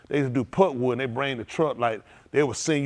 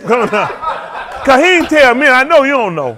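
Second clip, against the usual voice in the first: laughing protest; 9 semitones higher, 9 dB louder than usual. Real, not acted.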